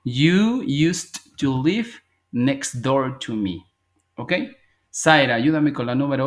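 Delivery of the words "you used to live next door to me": very slow, every word laid out; slow model sentence. Shown as a demonstration. Teacher models the corrected sentence for the class, very slow. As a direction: read it slowly so students can follow. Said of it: "Y digo".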